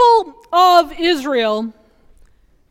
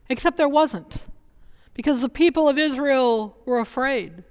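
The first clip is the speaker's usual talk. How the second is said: normal talking voice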